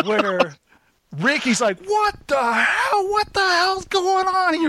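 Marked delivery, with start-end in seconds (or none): 1.9-4.7 high, slow shocked yell of disbelief; freaking out. Retold or imitated. Neighbor wakes to chaos at his pool, freaking out. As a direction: squeal what the hell in panic. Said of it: "He's, like, freaking out".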